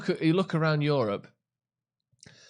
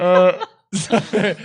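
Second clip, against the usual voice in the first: laughing; 6 semitones higher, 9 dB louder, much slower than usual. Real, not acted. Laughing apology.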